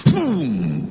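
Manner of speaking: long falling "boom" like a dropping scale; imitated boom. Shown as a demonstration. Preacher shows the scale tipping over, imitated boom. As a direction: draw out boom, sliding down in pitch.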